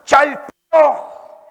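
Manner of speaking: high loud splash word Chalpó; splash sound. Shown as a demonstration. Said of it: "todos somos".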